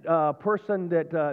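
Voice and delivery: normal talking voice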